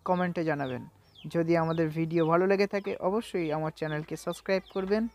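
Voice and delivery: normal talking voice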